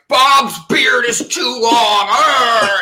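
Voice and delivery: loud, high, drawn-out mock shout; upset dad. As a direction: play an outraged dad growling "arr" at the end. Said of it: "he's all upset about it".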